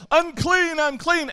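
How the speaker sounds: a loud, high cry of unclean; full-voiced unclean warning call; yelled warning. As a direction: shout it out as the outcast leper. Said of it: "whenever you got around somebody".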